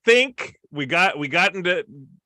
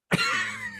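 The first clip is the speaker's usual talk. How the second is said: high squeaky laugh; 5 dB quieter than usual. Real, not acted. Laughs at the Gandhi joke.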